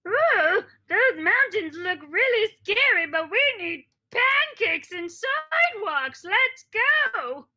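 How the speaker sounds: high, excited talking dog; high, loud, howly dog voice with drawn-out words; Tabitha. Acted. Talking dog rallies for the scary mountain, high howly voice. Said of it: "That was Tabitha".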